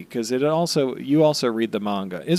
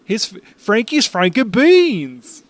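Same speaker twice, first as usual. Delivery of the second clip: high, slow goofy voice; silly character voice; 8 semitones higher, 4 dB louder, slower than usual. Pretend. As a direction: drag it out in a cartoon voice.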